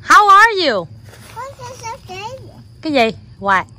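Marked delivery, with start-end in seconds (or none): 0.1-0.9 loud, high-pitched hello; excited greeting. Real, not acted. Parent greets child's visiting friend Audrey, excited greeting. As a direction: greet loudly and high, real delight.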